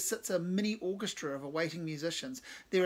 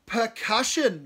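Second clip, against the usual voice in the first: higher, much louder delighted scream; 6 semitones higher, 11 dB louder, slower than usual. Acted. Cave thrilled at Glenn's answer, screaming. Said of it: "Cave screams".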